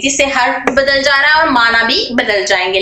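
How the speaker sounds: ordinary talk, nothing marked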